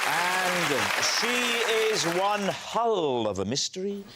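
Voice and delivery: upbeat TV host introduction; show host intro. Acted. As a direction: announce the guests with energy.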